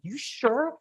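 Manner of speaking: high, sceptical "You sure?"; a high doubting question; doubtful. Retold or imitated. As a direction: ask it in disbelief, pitch up. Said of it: "I'm like".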